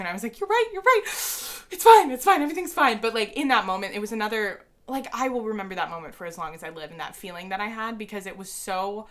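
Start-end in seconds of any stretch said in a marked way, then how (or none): 0.4-3.0 high squeaky voice, fighting back tears; squeaky self-imitation. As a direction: squeak it out while trying to hold it together. Holding back tears, squeaky reply.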